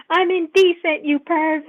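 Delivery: loud, high outraged scream; mock scream. Player's character screaming at the man grabbing her, mock scream. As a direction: scream it in hammed-up indignation. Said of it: "I just scream".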